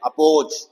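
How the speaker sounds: अपोज with a short schwa at the start; अपोज as uh-pose, first vowel weak; the correct अपोज with a schwa; right way. Shown as a demonstration. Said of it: "ओपोज नहीं है ये".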